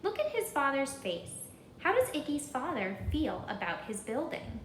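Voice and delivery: usual speaking voice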